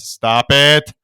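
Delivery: loud, flat stern order; loud German businessmen telling him to quit; stern scolding. Retold or imitated. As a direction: tell him off firmly.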